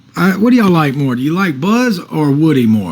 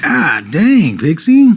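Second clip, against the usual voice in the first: sharp rising call of the dog's name, higher; startled call to the dog; 4 semitones higher, much slower than usual. Real, not acted. Streamer startled, calling his dog Pixie by name. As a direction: catch the dog in the act.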